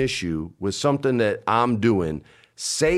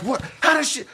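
very high, flustered retold question; caught-out disbelief. Man retelling being caught in the Mario shirt, flustered. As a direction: act baffled at being found out.

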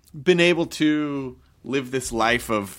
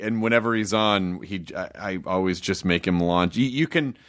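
ordinary talk, nothing marked

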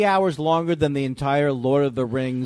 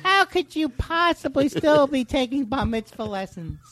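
pestering caller voice; 10 semitones higher than usual. Retold or imitated. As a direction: pester him like a needy friend. Imitating Todd's pestering calls.

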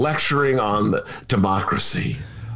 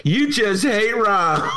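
shouted Romano imitation; 6 semitones higher, slower than usual. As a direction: yell it like the teasing colleague would. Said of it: "Romano's gonna say".

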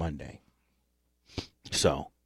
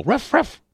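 high mock dog bark; 12 semitones higher than usual. Shown as a demonstration. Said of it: "all of us can go".

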